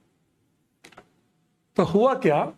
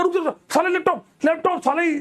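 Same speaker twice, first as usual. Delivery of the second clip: ऊँची, घबराई हुई चीख़कर रोकना; लैपटॉप बचाने के लिए ऊँचे सुर में चिल्लाओ; panicked shout; 9 semitones higher than usual. Retold or imitated. Stopping friend hitting laptop. (दोस्त को लैपटॉप पर वार से रोकते हुए घबराई चीख़)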